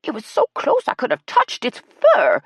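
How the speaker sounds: high, loud excitement; exclaiming. A narrator's reading. Ella thrilled by the close encounter, loud and high. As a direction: gush at top pitch. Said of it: "Ella exclaimed".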